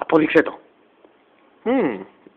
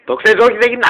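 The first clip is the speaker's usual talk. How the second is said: firm refusal; 6 semitones higher, 6 dB louder, much faster than usual. Acted. Mother refusing the kid loudly.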